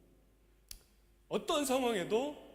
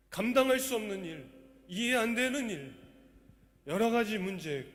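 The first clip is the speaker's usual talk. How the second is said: high, loud impassioned preaching; full-force preaching; 6 semitones higher, 8 dB louder than usual. Acted. Pastor to congregation, how the poet held on, full-force preaching. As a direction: proclaim it with fire to the congregation.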